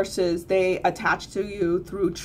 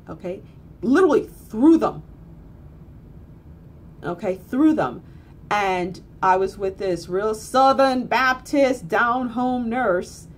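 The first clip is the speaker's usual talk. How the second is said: ordinary talk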